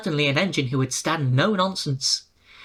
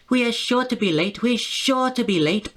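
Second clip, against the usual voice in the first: higher, mildly frantic; frantic panting; 6 semitones higher than usual. A narrator's reading. Engine worried about missing Henry, mildly frantic. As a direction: puff it out anxiously. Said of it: "panted Peter Sam frantically".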